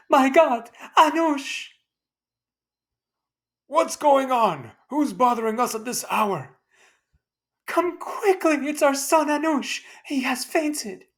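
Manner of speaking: high, loud frantic calling; mother crying out in alarm. Acted. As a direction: panic over her fainted son. Mother finding fainted son.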